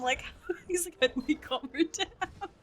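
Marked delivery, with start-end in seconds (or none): 1.0-2.5 higher, giggly mock panic; lightly panicked high voice; flustered dad. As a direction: imitate a helpless dad, nearly laughing. Mimics dad at a loss, giggling.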